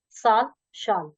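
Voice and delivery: slow साल with "s", शाल with "sh"; s versus sh. Shown as a demonstration. Teacher drilling word pairs, साल against शाल, slowly. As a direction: space out साल and शाल, contrasting their first sounds. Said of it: "उच्चारण करते हुए अंतर समझिए".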